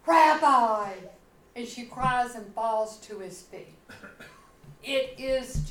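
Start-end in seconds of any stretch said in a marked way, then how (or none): 0.0-0.9 loud joyful cry of recognition; Mary yells on recognizing her teacher, a loud cry; yelled. A narrator's reading. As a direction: call out loud, overwhelmed with sudden recognition. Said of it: "she yells".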